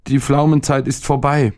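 put-on high-pitched grumpy boy; a touch of sulky child; sullen grumbling. A narrator's reading. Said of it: "brummte der Junge verdrießlich". (leicht verdrießlich, hohe Jungenstimme)

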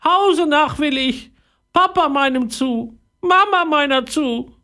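whining parrot. Acted. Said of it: "jammerte der Papagei".

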